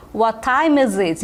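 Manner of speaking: slow, careful English; slow model phrase. Shown as a demonstration. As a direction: model it slowly for learners. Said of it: "Também muito utilizado dessa expressão". (frase lenta e clara)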